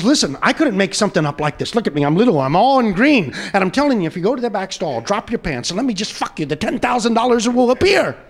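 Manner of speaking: urgent persuasion. Acted. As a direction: plead your case, building in volume. Leprechaun urgently persuading the man.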